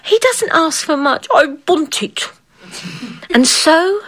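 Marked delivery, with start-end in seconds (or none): 1.3-2.3 lightly voiced demanding girl; a hint of a pouting brat; whiny royal demand; spoilt little queen. A narrator's reading.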